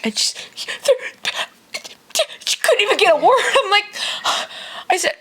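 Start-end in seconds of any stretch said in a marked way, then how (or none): 0.8-3.8 high, choked-up mock sobbing; imitating a child crying hard; imitated hysterical crying. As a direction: act too tearful to get words out. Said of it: "starts hysterically crying".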